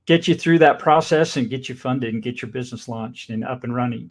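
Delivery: ordinary talk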